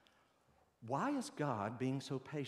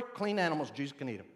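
ordinary delivery

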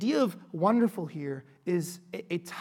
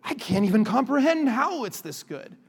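raised, louder voice of disbelief; exaggerated astonishment; mock amazement; 5 semitones higher, 5 dB louder than usual. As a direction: play at being overwhelmed with wonder. Acts out overawed ice cream eater.

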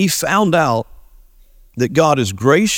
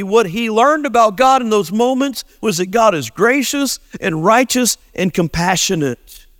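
ordinary talk, nothing marked

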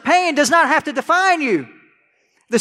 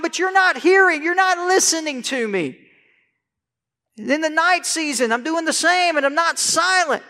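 pleading prayer; 6 semitones higher than usual. Acted. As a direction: plead as if unheard. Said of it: "He says".

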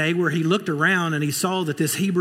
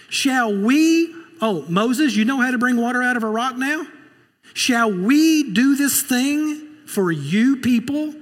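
higher, louder mocking taunt; sneering Moses; 7 semitones higher, 4 dB louder than usual. Preacher voicing Moses taunting the grumbling people, loud sneer. Moses voiced scornfully to crowd.

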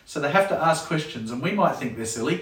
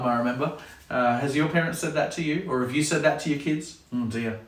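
ordinary delivery

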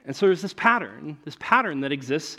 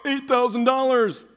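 shocked price read; 7 semitones higher than usual. Retold or imitated. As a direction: say it like the price hit you.

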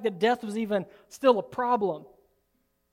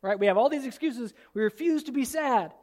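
ordinary talk

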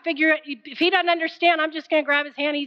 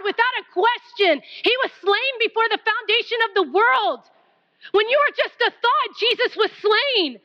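high, driving sermon voice; full-force preaching; 7 semitones higher than usual. Acted. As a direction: exhort the congregation with force.

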